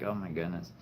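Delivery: soft, stunned murmur; hushed awed oh my goodness. Retold or imitated. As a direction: say it quietly, overwhelmed. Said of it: "I am really surprised I haven't cried".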